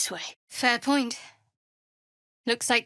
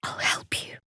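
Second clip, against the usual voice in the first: hushed unvoiced whisper; soft whispered promise; 3 dB quieter than usual. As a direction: breathe the promise quietly. Same line as 98, whispered.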